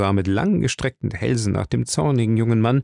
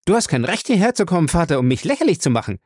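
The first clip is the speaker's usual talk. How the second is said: angry at father; 7 semitones higher, faster than usual. Acted. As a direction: hurl the reproach at him. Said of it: "Er blitzte seinen Vater zornig an". (zornig zum Vater)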